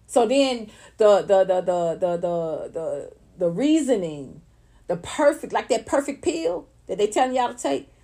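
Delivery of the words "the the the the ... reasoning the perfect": usual speaking voice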